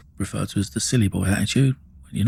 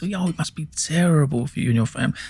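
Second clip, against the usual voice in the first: higher, smarmy mock sympathy on terrible; sarcastic; 6 semitones higher than usual. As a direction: sneer it as fake concern. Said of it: "Sarcasm at its best".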